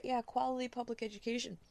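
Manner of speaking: high-pitched, scornful repeat; sarcastic echo. Real, not acted. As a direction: throw the phrase back with sarcasm. Scornful echo of the article's phrase.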